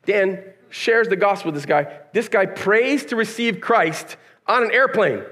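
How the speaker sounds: usual speaking voice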